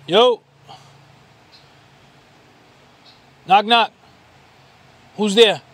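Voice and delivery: loud, high call toward the tents. Real, not acted. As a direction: call out to see if anyone is home. Calling out to tent.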